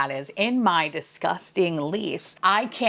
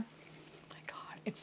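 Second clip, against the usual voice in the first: breathy awed whisper; hushed awe over a favorite spray; 23 dB quieter, slower than usual. Real, not acted. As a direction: whisper it like a secret. Whispered reaction to product.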